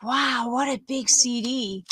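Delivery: slow, wide-eyed little girl, lightly voiced; a hint of a toddler marvelling at a record; mild, slow child's wonder; amazed little kid. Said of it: "she's like".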